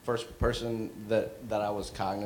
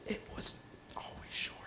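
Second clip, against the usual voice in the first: hushed matter-of-fact whisper; 11 semitones higher, 6 dB quieter, faster than usual. Retold or imitated. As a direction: whisper it back plainly. Brother whispering in the dark.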